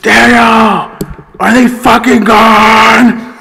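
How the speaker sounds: high, loud, slow yell from another room; retold scream. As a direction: yell it from down the hall. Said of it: "you can hear Julie scream".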